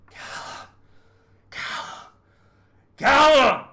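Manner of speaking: calls building to a full scream; shouting a name. Acted.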